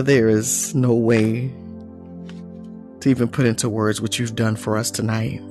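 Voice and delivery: normal talking voice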